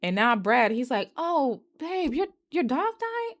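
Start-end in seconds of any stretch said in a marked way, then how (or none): 1.2-3.4 much higher, put-on concerned boyfriend; mock boyfriend voice. Brad asking his girlfriend about her dog, high put-on voice. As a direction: play Brad as fake-sympathetic, pitched way up.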